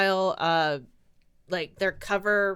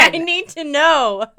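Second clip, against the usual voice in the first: mock demanding; 6 semitones higher, 11 dB louder, slower than usual. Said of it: "she demands an explanation".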